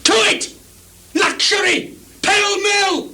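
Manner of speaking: high frenzied yell rising to a shout; raving shout. Acted. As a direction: hurl it out like a madman. Lear raving about lust.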